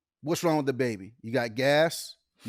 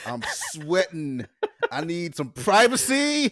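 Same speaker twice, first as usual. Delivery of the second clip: slow, laughing desperate plea; 4 semitones higher, 5 dB louder, much slower than usual. Retold or imitated. To friend at the barbecue, a slow laughing plea. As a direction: beg for privacy through laughter.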